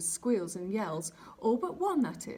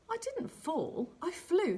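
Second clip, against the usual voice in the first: higher, slow offended protest; indignant; 9 semitones higher, slower than usual. Acted. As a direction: stand tall and insist on it. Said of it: "drew himself up as tall as he could".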